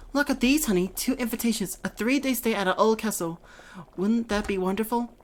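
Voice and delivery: a hint of a bubbly wife showing off invitations; excited wife. Voices the wife in game dialogue.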